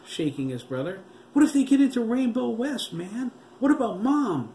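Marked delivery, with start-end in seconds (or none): 1.3-4.5 much higher, louder frantic shout; boy fearing zombies reach his mom; frantic yell. A narrator's reading. Frantic high shout. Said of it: "J T snapped".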